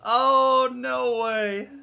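high, loud oh then no way; disbelief. Real, not acted. Racer reacting to the lost win, disbelieving cry. Disbelief after losing race.

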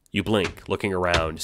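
ordinary talk

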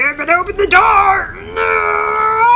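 high, loud panicked yell; real startled shout; alarmed shout. Real, not acted. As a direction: cry out in sudden alarm. Panicked reaction in a game.

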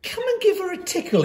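bright, fast, high coaxing; encouraging. A narrator's reading. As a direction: Miranda coaxing her classmates, eager and quick. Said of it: "encouraged Miranda".

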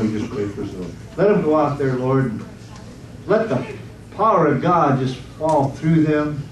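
ordinary delivery

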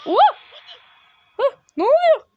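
high, loud cry of shock; high-pitched startled yelp. Real, not acted. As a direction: jump out of your skin. Startled by the game.

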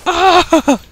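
loud, high-pitched "ah"; high cry. Real, not acted. Player reacting to the fight, high cry. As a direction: let out a high yelp. Cries out in game.

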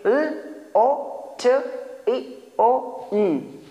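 put-on लोटियन sounded out letter by letter like a confused child; child's wrong letter-by-letter reading. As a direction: imitate a child misreading. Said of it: "बच्चा इधर confuse होगा".

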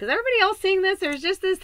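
high, fast, laughing disbelief; giddy laughing squeak of amazement; amazed squeal. Retold or imitated. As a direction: gush it out in wide-eyed wonder. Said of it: "and I was just like".

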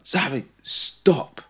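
slow, drawn-out stern bark; slow stern bark at a toddler; barked command. Retold or imitated. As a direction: bark the name, then stop, slowly. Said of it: "it's only when I bark".

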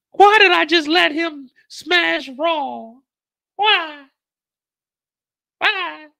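very high, drawn-out mock wail; wailing woman. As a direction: wail it loud and high, stretching the words. Acting Effie crying in bathroom.

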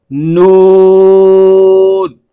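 नून drawn out slowly with no sound through the nose; clear, no nasal. Shown as a demonstration. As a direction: say नून slow and clean, no nasal hum. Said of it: "बल्कि". (नून धीरे और साफ़, नाक में आवाज़ ले जाए बिना)

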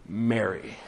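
low, soft, unhurried call of a name; tender calling. Acted. Risen Jesus calling a weeping woman by name, tender. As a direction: say the name gently, letting silence follow.

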